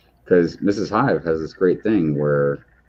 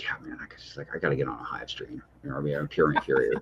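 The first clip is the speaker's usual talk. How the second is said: hushed mock voice; 5 semitones lower, 10 dB quieter, faster than usual. Mimicking wife when mad.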